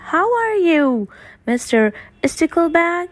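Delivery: character voice. Acted. Greeting in character, high.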